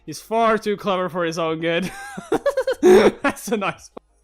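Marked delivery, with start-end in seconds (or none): 2.0-2.8 shrill-high laughing squeal; high squeal of laughter. Real, not acted. Streamer reacting to the clever merchant, squealing laugh. 2.8-4.2 high, loud, slow laughing praise; laughing delight. Real, not acted. Streamer praising the merchant's trick while laughing.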